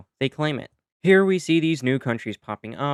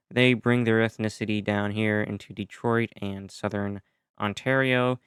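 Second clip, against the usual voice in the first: usual speaking voice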